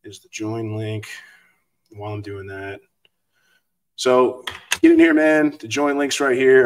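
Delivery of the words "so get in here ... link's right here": loud urging call. Real, not acted. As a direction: hype the audience to join. Calling viewers into the stream.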